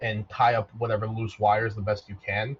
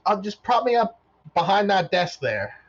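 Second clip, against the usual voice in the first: high chirpy robot instruction; cheerful robot giving directions; 8 semitones higher, 4 dB louder than usual. Acted. Robot telling Vaughn where to set him, chirpy. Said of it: "and he goes".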